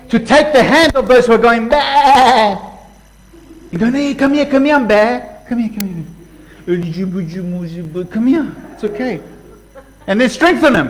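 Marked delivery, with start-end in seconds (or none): 1.7-2.6 lamb bleat. Pretend. Mocking weak lamb bleat. 3.9-9.2 gentle coaxing. Pretend. Coaxing a weak believer over.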